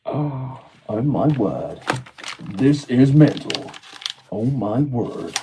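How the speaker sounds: usual speaking voice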